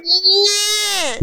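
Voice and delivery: very high, hammed-up crying shout of yes; mock wail. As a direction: wail the word like a sobbing cartoon. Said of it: "And she's like".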